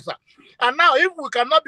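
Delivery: ordinary talk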